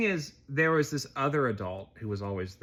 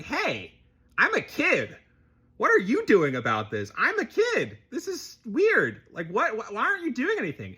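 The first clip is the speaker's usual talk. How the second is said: high-pitched mock protest of a fed-up child; outraged kid; 10 semitones higher, 4 dB louder than usual. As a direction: act out a kid demanding answers, voice shooting way up. Older kid confronts passive adult.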